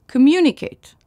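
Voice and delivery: "communicate" with a high, loud MU; stress on second syllable. Shown as a demonstration. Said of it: "l'accent tonique est sur la deuxième syllabe". (« communicate » accentué sur la deuxième syllabe)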